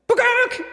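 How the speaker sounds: sudden high chicken cluck; chicken squawk. Shown as a demonstration. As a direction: squawk it out like a hen. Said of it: "here's what a chicken sounds like".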